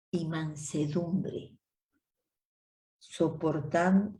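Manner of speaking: slow, measured Bible reading; scripture reading. Acted. Sister reading Efesios to the women's group, slow and solemn. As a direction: read each phrase slowly and solemnly.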